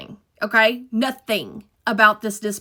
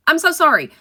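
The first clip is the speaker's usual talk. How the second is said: normal talking voice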